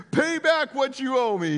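high, loud, harsh demand. Acted. Servant choking his fellow servant, loud angry demand. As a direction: bark the demand for payment. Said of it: "he demanded".

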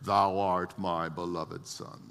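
low, slow mock-solemn voice of God; put-on reverent scripture voice; holy reading. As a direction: intone it slowly, low and pious. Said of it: "read it a little more holy".

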